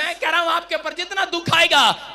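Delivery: loud, high sermon promise; full-force preaching. Acted. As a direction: proclaim it forcefully to the congregation.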